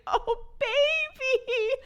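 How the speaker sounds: very high, slow, stretched coo. Retold or imitated. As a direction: coo it like to a stuck baby. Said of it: "I was like".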